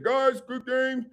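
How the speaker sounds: high, slow coach voice; imitating Wade Phillips. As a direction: mimic the coach's slow praise. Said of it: "Wade Phillips came in".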